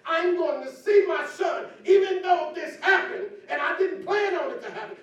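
loud, high shout of faith; shouted declaration. Acted. Pastor voicing David's hope to the church, shouting.

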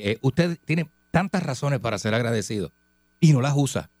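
usual speaking voice

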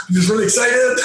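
high, eager excited reply; bubbling over with excitement. Retold or imitated. Fan telling the art director he is excited, eager. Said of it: "I said".

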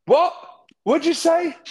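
very high, squeaky questioning voice; mock not hearing. Driver asking fuzzy radio in tunnel, mock not hearing. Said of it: "I just go".

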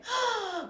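big theatrical gasp, high; mock gasp. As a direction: gasp in fake shock. Fake shock at news.

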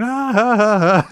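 put-on high, loud drunken cackle; laughing. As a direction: laugh it off carefree.

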